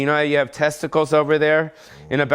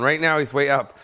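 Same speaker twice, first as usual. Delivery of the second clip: usual speaking voice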